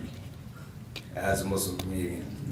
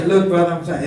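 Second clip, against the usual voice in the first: pleading while laughing, louder; laughing plea; 7 semitones higher, 12 dB louder than usual. Retold or imitated. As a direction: beg through the laughter. Acting out cold call plea.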